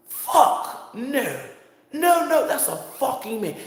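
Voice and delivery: loud, high-pitched refusal; high, scandalized disbelief; appalled denial. Retold or imitated. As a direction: cry out in hammed-up horror. Re-enacts the man's appalled denial.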